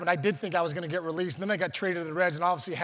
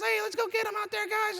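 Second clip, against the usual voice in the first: put-on much higher, squeaky pre-puberty teen voice; boyish high voice. Shown as a demonstration. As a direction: sound like a kid whose voice hasn't broken yet. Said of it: "I kinda was still talking like this".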